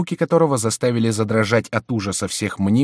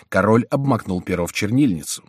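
ordinary delivery

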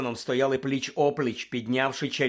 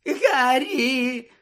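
high, squeaky cry for mercy; cringing high-pitched appeal; desperate plea; 12 semitones higher, 6 dB louder than usual. Acted. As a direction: call out in a thin, strained whimper. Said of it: "прошепотів Петтігру".

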